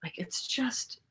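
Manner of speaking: breathy whisper under the breath. Real, not acted. Whispering to a friend during the film. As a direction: murmur it so nobody hears. Muttering while watching the film.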